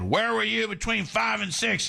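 high-pitched cop's question, lightly acted; questioning police officer. Police officer to his suspect in a joke, high-pitched questioning.

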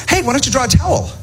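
woman in the audience. Retold or imitated. Comic imitates a woman suggesting a towel, high light voice. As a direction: pitch up as the helpful lady. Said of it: "This woman one night said".

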